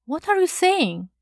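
high, disbelieving question; astonished, pitch leaping up; amazed cry. A narrator's reading. Shocked colleague challenges the narrator, amazed cry. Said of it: "he cried, amazed at my calm".

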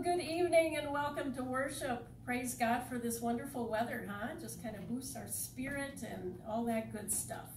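normal talking voice

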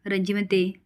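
plain reading voice

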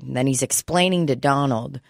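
ordinary talk, nothing marked

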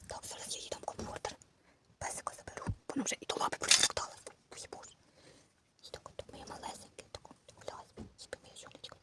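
barely audible bedside whisper; very soft, breath-only hush. Pretend. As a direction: whisper so the baby stays asleep. Baby has fallen asleep.

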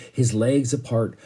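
ordinary delivery, nothing marked

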